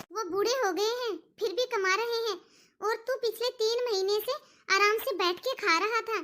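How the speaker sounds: usual speaking voice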